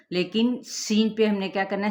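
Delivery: ordinary talk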